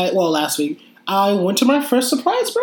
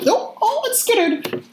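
mock fright; slower than usual. Reliving surprise party anxiety.

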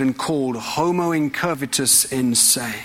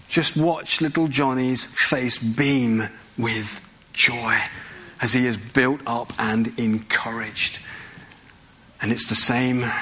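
ordinary delivery

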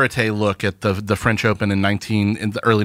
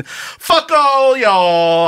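high, long drawn-out send-off; playful defiance; 11 semitones higher, 4 dB louder, much slower than usual. Real, not acted. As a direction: stretch it out in gleeful defiance. Defiant sign-off after losing argument.

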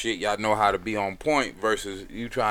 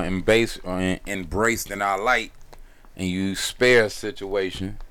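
ordinary delivery

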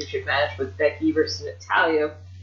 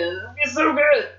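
high, giddy fan enthusiasm; gleeful excitement; 7 semitones higher than usual. Real, not acted. Booker thrilled by the planned storyline, gleefully excited. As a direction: gush about it, pitch lifted high.

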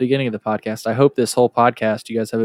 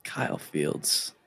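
ominous whisper; 7 semitones lower, 10 dB quieter, slower than usual. Acted. Mock ominous reaction to name.

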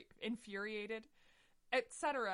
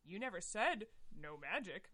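ordinary delivery

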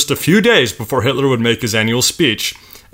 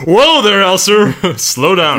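1 laughing mock scolding; 6 semitones higher, 4 dB louder, slower than usual. Teasing Elser over coffee.

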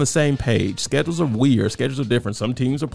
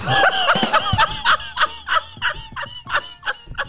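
long high-pitched cackle of a laugh. Real, not acted. Laughs at his own joke.

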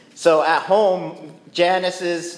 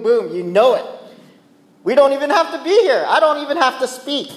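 higher, louder smug know-it-all voice; mock smugness; 7 semitones higher, 4 dB louder than usual. Preacher mocking know-it-all churchgoers, smug and loud.

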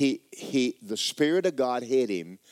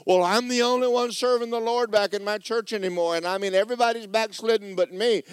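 high, louder self-pitying whine; whining Elijah; 11 semitones higher, 7 dB louder than usual. Acted. Elijah whining to God that everyone backslid. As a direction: complain like you alone are faithful.

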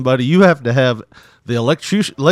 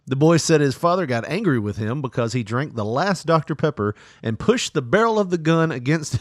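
ordinary talk

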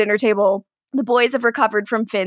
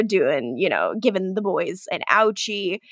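ordinary talk, nothing marked

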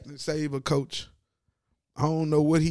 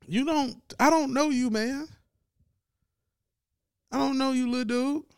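higher, dismissive mock player voice; imitating the player; 10 semitones higher than usual. Player dismissing the head coach, higher mock voice. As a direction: brush off the little coach.

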